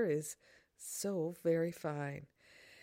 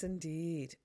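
ordinary talk

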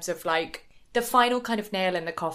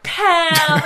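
higher, louder mock cry of a name; dramatic call; 9 semitones higher, 9 dB louder than usual. Podcaster imitates Kim calling to her friend, loud and laughing.

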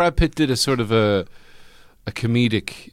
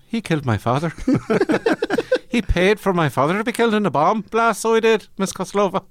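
higher hammed-up Irish movie accent; mock Irish film victim; 9 semitones higher than usual. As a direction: play a grieving son in a melodramatic film. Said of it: "thinking the whole time".

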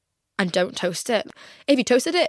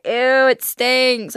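disgusted; 4 semitones higher, 5 dB louder, much slower than usual. Retold or imitated. Makeup artists disgusted.

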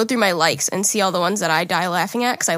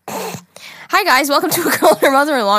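upbeat host intro; 5 semitones higher, 3 dB louder than usual. Acted. Show intro.

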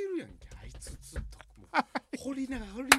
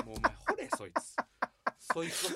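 laughing; 11 semitones higher, 6 dB louder than usual. Real, not acted. Laughing banter.

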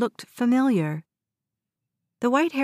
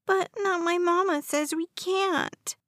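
put-on much higher, pitiful child's voice; slightly trembling; 9 semitones higher than usual. A narrator's reading. West complains to Grandma Lucy about his mama, pitiful high tremble. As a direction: lay on the small boy's sob story. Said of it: "his voice trembling slightly".

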